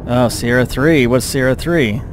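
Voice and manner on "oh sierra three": ordinary talk, nothing marked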